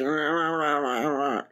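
low, mumbled nonsense like someone half asleep; mock sleepy grumble. Imitating Nikki refusing to get up, low sleepy grumble. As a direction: grumble it like a friend who won't get out of bed. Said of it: "And you'd be like".

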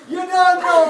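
loud, high punchline shout; shouted catchphrase. Acted. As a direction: belt it out.

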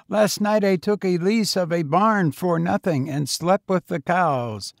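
put-on high, chatty boy's voice; streetwise tramp boy. A narrator's reading. Boy character in dialogue.

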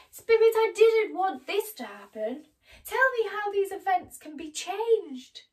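high, loud desperate plea; distraught pleading. Acted. Scrooge begging the Spirit to change events, loud and desperate. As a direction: beg as if it's not too late. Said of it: "Scrooge cried".

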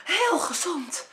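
slow, puffed-out answer; mock panting. Ad tired from sport, mild panting. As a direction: sound winded after the workout. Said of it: "hijgt Ad".

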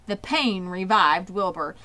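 ordinary delivery

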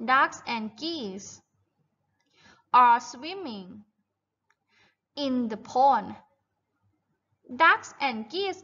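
very slow, stretched-out sentence; model reading for repetition. Shown as a demonstration. Teacher reads the farm text for children to repeat, slow model reading. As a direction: read it out slowly, word by word. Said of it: "อีกครั้งนะคะ".

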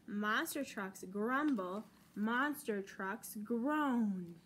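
usual speaking voice

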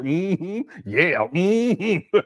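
imitating agreeing lady. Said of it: "she sure sitting up there".

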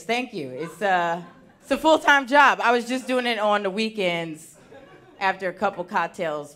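ordinary delivery

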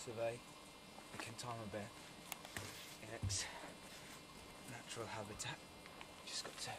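hushed, whispered wildlife narrator; nature documentary. Acted. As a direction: softly narrate as if near a wild animal.

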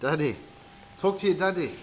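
ordinary delivery

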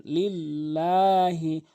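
slow লিল্লাহি with লা held one alif; লিল্লাহি, long drawn লা; stretched lam. Shown as a demonstration. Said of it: "এখানে লাম অবশ্যই এক আলিফ টানবেন".

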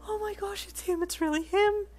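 very high, loud excited squealing; fangirl squeal. A narrator's reading. You spot Todoroki in person, fangirl squeal. Fan sees idol in person.

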